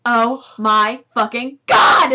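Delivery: loud, slow, drawn-out fangirl scream; mock scream. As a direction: stretch it out like a thrilled fan. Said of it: "I screamed".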